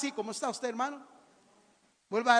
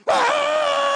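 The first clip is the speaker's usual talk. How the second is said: loud, high held cry; hammed-up sustained wail of grief; mock wail; 11 dB louder than usual. Mourning sister who keeps crying, mock loud wail.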